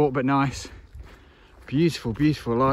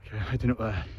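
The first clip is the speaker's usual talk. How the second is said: heavy breathing; 2 semitones lower, 12 dB quieter than usual. Real, not acted. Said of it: "Sorry for the heavy breathing".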